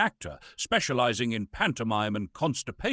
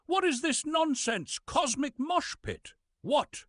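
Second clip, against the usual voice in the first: much higher, baffled outburst; incredulous; 14 semitones higher, slower than usual. Acted. Actor reading his lecture script, incredulous and much higher. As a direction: squeak out your disbelief at the script.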